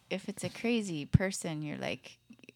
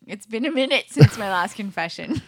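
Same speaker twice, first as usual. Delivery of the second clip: laughing confession; 2 semitones higher, 8 dB louder than usual. Pretend. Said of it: "I'm gonna be the priest now".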